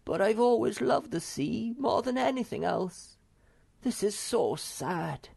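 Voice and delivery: high, sorrowful confession; sad Snufkin. Acted. Wanderer explains his grief to friend, high and sad.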